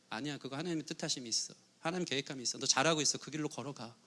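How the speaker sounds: softly reassuring a friend who doubts. Retold or imitated. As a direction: comfort a doubting friend quietly. Spirit-filled friend reassuring.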